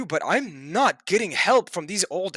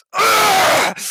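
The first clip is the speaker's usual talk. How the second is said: loud, high, strained roar of anger; furious grunt; 11 semitones higher, 7 dB louder than usual. Real, not acted. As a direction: let out an explosive angry grunt. Angry outburst before doing push-ups.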